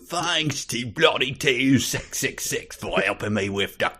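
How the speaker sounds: slow put-on Cockney accent. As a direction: read the note like a Cockney. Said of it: "Cockney author's note".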